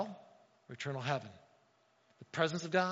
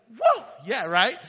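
excited; 4 semitones higher, 9 dB louder, slower than usual. Retold or imitated. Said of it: "and you'd be like".

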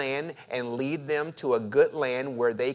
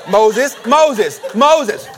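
loud, high, laughing calls of Moses; calling out; 5 semitones higher, 10 dB louder, much slower than usual. Acted. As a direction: holler the name across the field, chuckling. Many voices calling Moses loudly.

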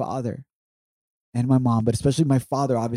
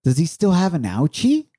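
lightly voiced small child, higher pitch; higher, innocent child's question; three-year-old daughter; 6 semitones higher, 3 dB louder than usual. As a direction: imitate a little girl asking her dad. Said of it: "She asked".

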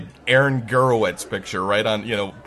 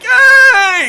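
loud, high mock-delighted shout; big fake-familiar yell; hollered greeting; 14 dB louder than usual. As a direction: holler back like a long-lost pal. Returns the surfer's shouted greeting.

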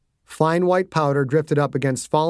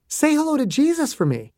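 put-on high, bright little girl; cheerful child voice; 12 semitones higher than usual. A narrator's reading. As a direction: chirp it innocently. Child's farewell.

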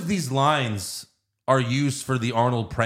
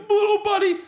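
much higher, cooing with a laugh; Big Show voice; 4 dB louder, faster than usual. Retold or imitated. Movie fan voices Big Show cooing at Mini-Me, high and laughing. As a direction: coo it like a dismayed giant. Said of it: "He's like".

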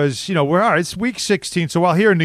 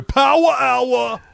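fan catchphrase; 8 semitones higher, much slower than usual. Retold or imitated. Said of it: "how many times did I hear".

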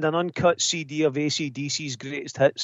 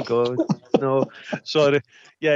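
normal talking voice

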